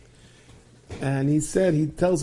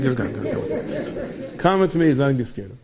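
playful ghost beckoning. Retold or imitated. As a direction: coax them along like a cheerful spirit.

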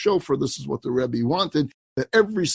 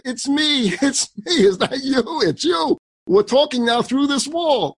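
much higher, excited call back; 11 semitones higher, 3 dB louder than usual. Retold or imitated. Rabbi excitedly answers the woman through the porch wall.

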